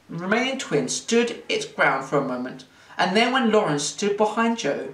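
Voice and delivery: usual speaking voice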